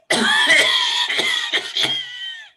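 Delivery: long loud cough with high wheeze; wheezy cough. Shown as a demonstration. Teacher demonstrates small airway cough with wheeze. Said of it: "this is how you get the cough".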